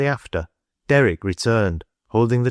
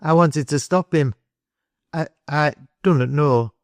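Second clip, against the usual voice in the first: put-on higher boy's voice, breaking off and restarting; faltering; 6 semitones higher than usual. A narrator's reading. Boy struggles to explain to the adults, faltering. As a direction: hesitate nervously in a raised pitch.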